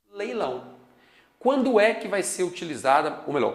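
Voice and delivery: ordinary delivery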